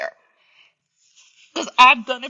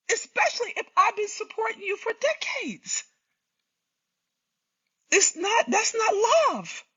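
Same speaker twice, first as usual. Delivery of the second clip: crying; 6 semitones higher than usual. Real, not acted. Hurt speaker breaks down on stream.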